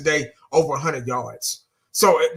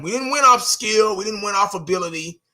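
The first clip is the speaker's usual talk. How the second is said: ordinary delivery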